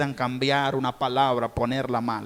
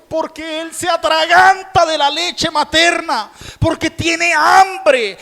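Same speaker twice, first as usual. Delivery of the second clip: loud high fervent preaching; impassioned pulpit sermon; preaching at full force; 12 semitones higher, 8 dB louder than usual. Acted. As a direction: pour it out to the congregation, full volume.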